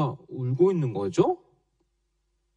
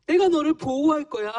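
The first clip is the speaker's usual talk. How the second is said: God's promise; 6 semitones higher than usual. Acted. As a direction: promise it like a loving father. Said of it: "라는 하나님께서 우리에게 약속을".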